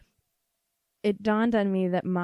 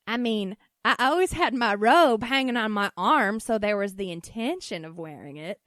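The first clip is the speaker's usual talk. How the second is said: put-on loud defensive Southern-accented mom, easing off; 5 dB louder than usual. Mom insists she had her robe, defensive Southern accent. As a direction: protest like a Southern mom making excuses. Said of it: "this weird accent that I'm choosing".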